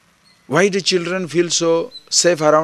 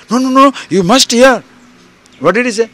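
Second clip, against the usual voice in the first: insistent friend; 7 semitones higher, 7 dB louder than usual. Acted.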